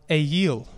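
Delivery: "ayil" opened with an "ay" as in day; Hebrew pronunciation. Shown as a demonstration. Teacher giving the congregation the Hebrew word, "ayil" as AY-yeel. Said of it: "the word in Hebrew is".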